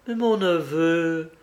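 lightly voiced astonished aunt; surprised exclamation. A narrator's reading. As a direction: exclaim slowly to your nephew, mildly taken aback. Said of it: "s'écria Olive en entrant".

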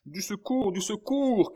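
very high, loud call for help; crying out. A narrator's reading. Old man arriving at the surgeon with wounded child, shouting. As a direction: shout for help, pitched right up. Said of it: "cria le vieillard".

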